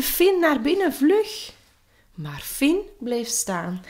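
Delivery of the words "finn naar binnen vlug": high, loud, urgent warning; frightened shout. A narrator's reading. Scared knights calling Finn inside, frightened shout. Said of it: "Ze riepen".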